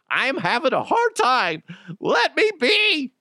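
hammed-up self-pity; mock whine. Host mocks his own stumbling, high and whiny.

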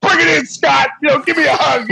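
playful retold invite to hug; mock buddy invite. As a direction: pull a crying stranger into a hug. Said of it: "and I'm like".

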